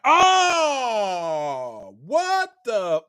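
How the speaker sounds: long, drawn-out stunned "oh"; mock astonishment. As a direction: draw out the gasp of retold amazement. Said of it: "then they astonished".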